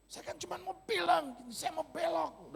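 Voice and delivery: high-pitched exasperated protest. Retold or imitated. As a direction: push the pitch right up as you protest. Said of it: "saya cuman tinggi gitu". (protes kesal dengan nada tinggi)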